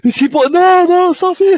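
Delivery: high, loud mock protest; hammed-up high-pitched pleading; mock panic. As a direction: shriek like someone begging them to stop. Acting out scared phobia sufferer.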